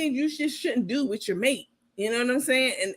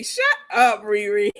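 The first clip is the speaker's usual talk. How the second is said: ordinary talk, nothing marked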